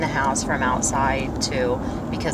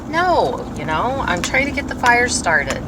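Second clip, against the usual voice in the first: higher and louder, dripping sarcasm; sarcastic answer to her husband, sharp and raised; sarcastic snap; 9 semitones higher, 4 dB louder than usual. Retold or imitated. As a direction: fire back a mocking, obvious answer.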